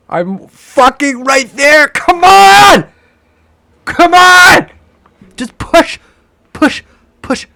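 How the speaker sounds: furious cheering at a price chart; desperate shouting. Real, not acted. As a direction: beg the chart at the top of your voice. Urging the price on.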